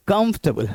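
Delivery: कंफर्टेबल squeezed to "kumf-tuh-bul"; three syllables. Shown as a demonstration. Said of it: "सिर्फ तीन syllables हैं".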